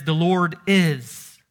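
slow, loud declaration landing on "is"; confident stress on IS. Shown as a demonstration. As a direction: declare it with certainty, stretching "is". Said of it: "a tone of confidence about this sentence".

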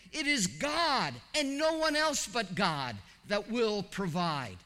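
"God" hit loud and high, repeated with force; emphatic. Real, not acted. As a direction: preach "no one else but God" with rising force. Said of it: "It's emphatic".

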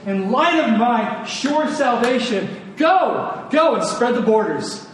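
ordinary talk, nothing marked

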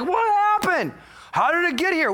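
high, mock-bewildered parent; mock shock. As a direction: cry out like a stunned parent. Parent shocked by child's marriage.

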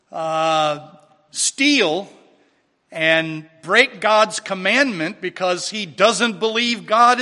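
loud, measured sermon delivery; projected pulpit voice, key words punched; emphatic preaching. Real, not acted. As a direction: preach it firmly to a congregation. Preaches to the congregation.

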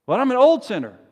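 loud, high-pitched objection; anguished protest, pushed high and loud; fearful sinner's protest. Acted. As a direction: cry out as a sinner afraid of rejection. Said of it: "the fears of the saints".

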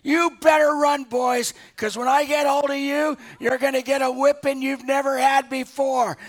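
loud furious warning shout; angry grandfather yelling at the grandsons; grandpa shouting. Retold or imitated. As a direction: bellow at the boys to scare them off. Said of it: "never heard my grandpa be so bold".

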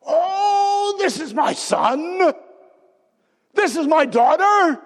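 high, ringing joyful proclamation; joyful shout. Acted. God announcing his children, ringing joyful shout. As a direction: shout it out like a proud father.

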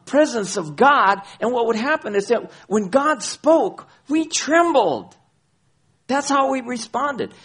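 high, loud preacher's cry; impassioned pulpit voice; fervent preaching. Acted. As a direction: carry the congregation with fire. Preaching at full force.

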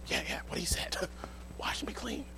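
unvoiced, nervous whisper; a servant backing up his friend; timid whisper. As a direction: hide behind the others and whisper.

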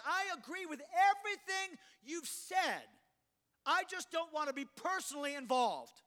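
high, stretched-out plea; high-pitched protesting Moses; pleading Moses. Acted. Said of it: "This is now Moses' response".

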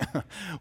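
short scornful chuckle; a brief mocking laugh before Pilate speaks. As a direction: laugh down at the prisoner. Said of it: "You can almost hear the laughter".

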